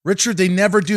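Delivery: loud, high exasperated cry; exasperated shout. Real, not acted. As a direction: vent your frustration loudly. Exasperated about producers.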